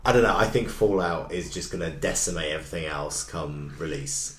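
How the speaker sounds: ordinary talk, nothing marked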